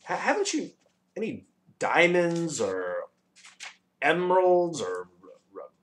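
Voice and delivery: high, drawn-out whiny question; irritable. A narrator's reading. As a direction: stretch out each gem impatiently. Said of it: "asked the bug irritably".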